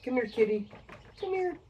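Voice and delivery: high-pitched coaxing pet call; calling a cat. Real, not acted. Whiskey reviewer coaxing the kitty to come here, high pet-calling voice.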